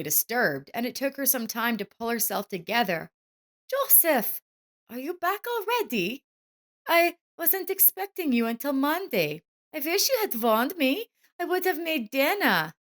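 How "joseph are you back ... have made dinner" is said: higher wife's voice, caught off guard; flustered wife. A narrator's reading. Wife surprised by husband's early return, higher. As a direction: cover surprise with fuss. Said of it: "she said".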